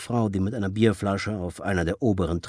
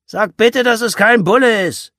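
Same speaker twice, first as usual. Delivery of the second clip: much higher, louder call after her; 10 dB louder than usual. Acted. Father calling after daughter heading to kitchen, loud. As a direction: shout it after someone leaving the room. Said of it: "Wolfgang rief ihr hinterher".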